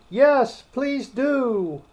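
very high, eager plea; eager chorus. A narrator's reading. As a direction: pipe up like an eager little crowd. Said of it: "the others all joined in chorus".

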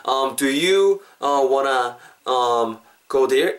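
slow, with drawn-out um and uh between DO YOU, WANNA and GO THERE; halting word by word. Shown as a demonstration. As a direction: stall on fillers after each chunk. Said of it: "막 천천히 발음해야 되는데". (더듬더듬 천천히 끊어서)

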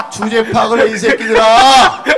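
loud, high, drawn-out comic yell; mock boss shout. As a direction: bellow a joking telling-off at your staff. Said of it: "제가 웃으면서 얘기하죠". (장난스럽게 길게 내지르는 호통)

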